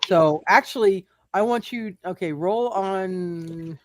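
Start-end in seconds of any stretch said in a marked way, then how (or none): none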